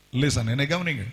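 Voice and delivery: usual speaking voice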